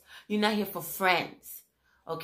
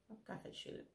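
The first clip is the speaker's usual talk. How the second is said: whispered; 5 semitones higher, 21 dB quieter, much faster than usual. Retold or imitated. Whispered end of retort.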